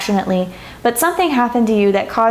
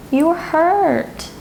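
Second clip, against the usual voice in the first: hurt; 7 semitones higher, much slower than usual. Real, not acted. Tarot reader moved by client's pain.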